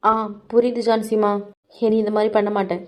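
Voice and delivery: soft, submissive apology to a stern elder; meek quiet reply. Acted. Scolded girl answers mother-in-law. (மாமியாரிடம் அடங்கிய மெல்லிய குரலில் பதில்)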